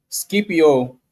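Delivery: ordinary talk, nothing marked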